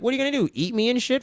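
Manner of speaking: high-pitched mock defiance; imitated defiant challenge. Captive girl challenging her captor, mock defiant. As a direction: throw it back as a high, incredulous taunt. Acting the girl's defiant retort.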